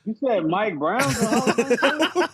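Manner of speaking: incredulous, laughing through it; half laughing, can't believe he said it; laughing disbelief. Real, not acted. Teammate laughing at Mike Brown claim, disbelieving. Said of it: "How dare you?".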